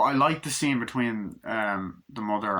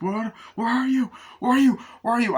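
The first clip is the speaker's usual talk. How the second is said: much higher, louder panicked cries; frantic calling; 13 semitones higher, 7 dB louder, faster than usual. Acted. Mother searching the beach for her son, frantic. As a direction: scream for the missing child.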